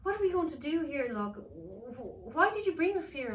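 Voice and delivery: put-on high, loud girl demanding answers; girl confronting the elf; upset girl. Girl character voice.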